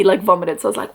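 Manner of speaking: usual speaking voice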